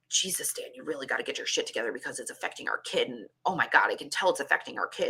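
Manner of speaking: hushed, near-whispered self-talk; muttering to herself under her breath; inner voice. Retold or imitated.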